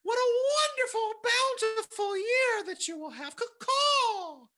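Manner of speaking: shrill, very high squawking bird voice; chirping bird person. Acted. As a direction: screech it out like a frantic cartoon bird. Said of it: "this bird flies up in your house and then says".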